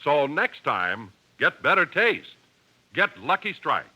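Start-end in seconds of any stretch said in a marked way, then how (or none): none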